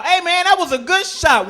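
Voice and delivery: booming locker-room praise; hearty praise. Acted. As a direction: slap a teammate's back with words. Praising teammate at basketball.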